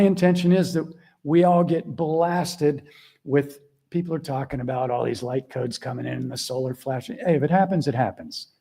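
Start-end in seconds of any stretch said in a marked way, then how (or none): none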